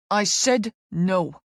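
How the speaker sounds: slow, firm male refusal; snapping refusal. A narrator's reading. Injured athlete refusing the exam, snapping. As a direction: shut her down hard. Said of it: "he snaps".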